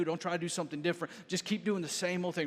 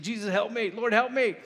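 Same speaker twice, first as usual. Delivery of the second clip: raised, higher-pitched plea for rescue; crying out; 4 semitones higher, 6 dB louder than usual. Retold or imitated. Peter crying out to Jesus for rescue, raised pleading cry. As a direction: call out for help, building on the repeat. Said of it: "You have to cry out for rescue".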